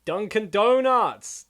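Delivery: loud, slow, delighted Dunkin' Donuts; a drawn-out happy yell of the shop name; excited shout. Real, not acted. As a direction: call it out like you spotted treasure.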